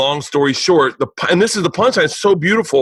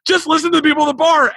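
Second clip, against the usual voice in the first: high, quick mock urgency; high-pitched telling-off; urgent scolding; 13 semitones higher, faster than usual. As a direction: imitate an exasperated cousin, pitched way up and fast. Cousin scolds teller in retold exchange.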